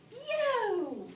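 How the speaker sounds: high, loud, falling call; exclaimed storybook line. Acted. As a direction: call it out like the boy in the book. Voicing boy's exclamation in book.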